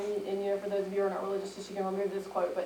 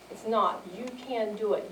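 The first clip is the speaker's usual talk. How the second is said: usual speaking voice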